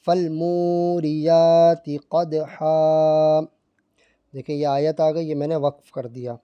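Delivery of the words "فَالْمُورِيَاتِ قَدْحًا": slow, drawn-out tajweed chant; Quran recitation. Shown as a demonstration. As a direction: recite it slowly with melodic stretching. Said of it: "اب میں یہ پڑھتا ہوں".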